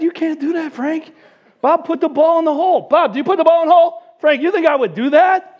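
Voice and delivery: high-pitched, urgent pleading; mock exasperation, pitched well up; acted protest. Teller arguing with friend over a faked hole in one, urgent protest.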